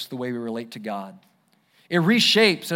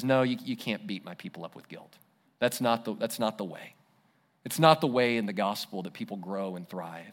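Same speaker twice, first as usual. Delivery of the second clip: low, soft, patient correction; 6 semitones lower, 4 dB quieter than usual. Retold or imitated. Jesus tells the pastor guilt won't help, low and soft. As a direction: quietly explain it like a kind teacher.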